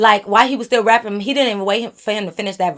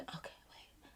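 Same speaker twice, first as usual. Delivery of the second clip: unvoiced whispered aside; 36 dB quieter than usual. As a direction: breathe it so he can't hear. Whispered aside while he raps.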